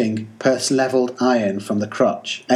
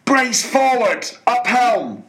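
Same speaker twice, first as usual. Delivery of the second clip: very high, slowed, ringing orders; sharp command cry; 13 semitones higher, slower than usual. Acted. Ahab commands his men to flee, high ringing orders. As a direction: bark the orders like lightning. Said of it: "cried Ahab, like lightning to his men".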